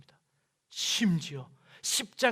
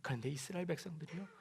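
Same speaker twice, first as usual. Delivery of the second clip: ordinary delivery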